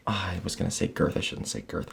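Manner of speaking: whispered aside; whispering. Real, not acted. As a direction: whisper it to yourself. Aside during speech.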